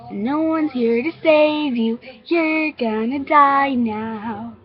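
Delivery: high, loud, drawn-out taunt; menacing threat. Acted. Girl with a knife threatens the child, drawn-out menace.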